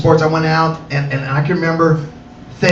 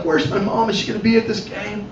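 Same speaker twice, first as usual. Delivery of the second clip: anxious inner hope; 8 dB quieter than usual. Retold or imitated. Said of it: "And I'm like".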